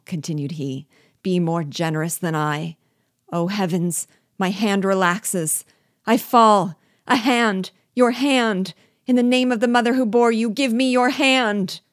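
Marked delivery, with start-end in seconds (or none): 1.2-11.8 much higher and louder, frantic; agonized pleading. Acted. Slipping dwarf screams to his master for his hand. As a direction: scream for a hand as you slip. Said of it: "the tone of agony in which he pleaded".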